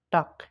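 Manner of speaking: "টাক" ending in a k sound; loud "টাক", final g hardened to k. Shown as a demonstration. Teacher showing g at word end, "টাক" closed with k. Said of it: "কর মতন উচ্চারণ হয়".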